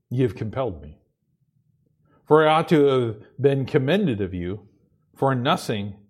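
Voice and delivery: low, quiet scripture reading with long pauses. Acted. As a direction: read it softly, pausing between phrases. Reads scripture aloud.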